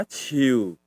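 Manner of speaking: ciu low and falling; tree tone. Shown as a demonstration. Teacher recaps three tones, ciu low falling. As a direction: let ciu drop low. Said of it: "kamu dengerin nadanya".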